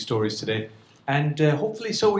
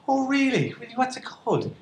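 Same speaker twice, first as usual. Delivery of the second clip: high, excited mock gasp of interest; imagined partner perking up at breakfast, mock surprised; mock surprise; 9 semitones higher than usual. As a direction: play the nosy partner, pitched up.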